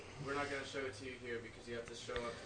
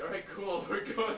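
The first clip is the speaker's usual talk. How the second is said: bright, louder, higher eager agreement; eager yes; 8 semitones higher, 9 dB louder than usual. Pretend. Guy imagining meeting a cool-named man, eager and louder. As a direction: jump at the chance, all in. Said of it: "I'd be like".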